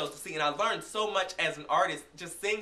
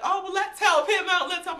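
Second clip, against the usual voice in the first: high, loud gushing clerk voice; mock gushing saleslady; 12 semitones higher, 8 dB louder than usual. Saleslady gushing at a customer, high and loud. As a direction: play the clerk all aflutter over a smiling customer. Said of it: "she's like".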